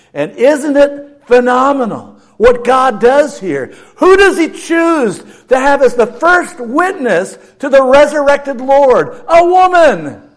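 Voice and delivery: loud, high, rising preaching; preaching at full force. Real, not acted. Pastor stressing a woman as first witness, full-force preaching. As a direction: drive it home to the congregation. Climactic sermon point.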